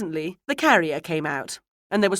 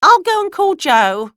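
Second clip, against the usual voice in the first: high, loud village woman's voice; Missus Baddock; 14 semitones higher, 13 dB louder than usual. A narrator's reading. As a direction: call back loudly, pitched right up. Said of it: "said a voice, Missus Baddock's".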